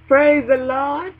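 ringing, drawn-out Praise the Lord; preaching praise. Shown as a demonstration. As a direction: stretch it out like calling the church. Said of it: "repeated praise, second one stretched".

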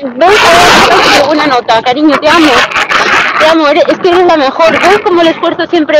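loud, gushing praise to her daughter; loud mock-tearful congratulations; hammed-up proud mum. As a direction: overdo the doting mother, almost crying.

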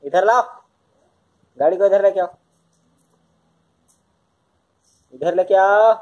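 loud call across the room. Real, not acted. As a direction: call her over loudly. Calling daughter to bring car. (बेटी को ज़ोर से बुलाते हुए, पुकार)

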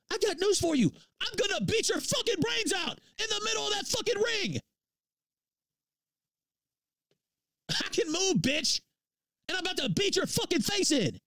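furious rant. Real, not acted. Streamer raging at rival Boogie over a fight, furious yelling. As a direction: tear into him, fuming.

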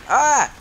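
short, high, loud yelp; sudden cry of fright; startled yelp. Real, not acted. Startled by infected in game.

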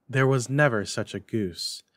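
ordinary delivery

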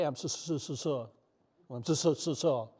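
lightly voiced Fonzie, unable to finish the word sorry; Fonzie stuck on sorry. A churchgoer too proud to apologize mimics Fonzie, stalling mid-word. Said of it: "we're like Fonzie".

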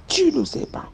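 high whispered command; whispered order. Retold or imitated. Nanny urging the girl to kill the snake, whispered. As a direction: urge it in a hush. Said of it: "Elle me dit".

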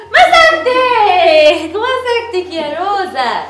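loud, drawn-out triumphant cry; gleeful gotcha. Pretend. Mom catching the girl in the game, loud and gleeful. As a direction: stretch the catch in delight.

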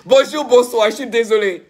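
high, giggling hello through laughter; laughing greeting. Real, not acted. Streamer laughing as he greets his viewers, giddy. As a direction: greet them while still cracking up. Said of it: "Je ne peux pas m'en empêcher".

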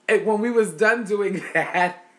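words breaking into laughter; laughing. Real, not acted. Telling viewers about chitlin duty, laughing.

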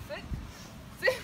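usual speaking voice

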